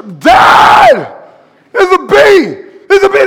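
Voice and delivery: high, loud scared yelling; child screaming about a bee; panicked kid. Acted.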